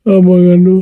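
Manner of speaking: loud pleading call, voice straining; desperate wail; anguished cry. Acted. Cries out to father in grief.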